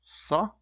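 "sa" with a heavy emphatic s. Shown as a demonstration. Teacher showing the sad letter's sound for learners. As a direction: darken the s in "sa". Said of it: "it's making the sound sa".

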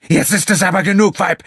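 high, loud roar; bellowing. A narrator's reading. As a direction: bellow at her to stop. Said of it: "brüllte er".

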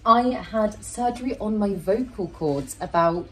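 ordinary talk, nothing marked

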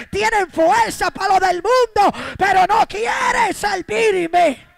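impassioned preacher voicing God; full-force preaching. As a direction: thunder God's rebuke at the church.

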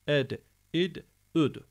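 "Ed, id, üd" each D rebounding; qalqalah echo. Shown as a demonstration. Teacher summing up three echo letters, bouncing "Ed, id, üd". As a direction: pop every final D with a tiny echo. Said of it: "üçünde de kalkale var".

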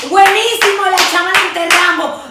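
loud, high, laughing mock celebration; stretched-out joking shout, pitched high; laughing mock cheer. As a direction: crow it through laughter, hammed up. Jokes about being declared dead.

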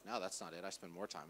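low, quiet mock protest; acted understated pushback; imitated objector. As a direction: murmur it like a self-assured listener. Voices objecting congregation members.

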